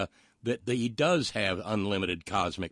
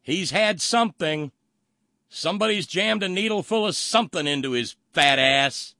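exasperated rant; 8 semitones higher, 8 dB louder than usual. Real, not acted. Exasperated rant reacting to clip.

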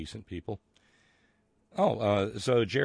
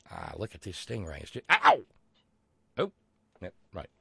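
hammed-up Australian croc hunter, yelping ow; bad Australian accent. Host mocks a stingray mishap in bad Australian accent. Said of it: "my bad Australian accent portion".

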